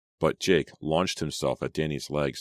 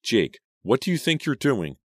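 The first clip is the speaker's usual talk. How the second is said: upset; 9 semitones higher, 4 dB louder than usual. Acted. Selena confronting Jake, high.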